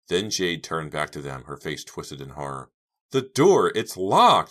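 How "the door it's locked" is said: high, very loud, horrified; wailing. A narrator's reading. Girl finding escape door locked, wailing. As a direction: wail it in panic. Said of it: "she wailed".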